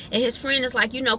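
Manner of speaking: ordinary talk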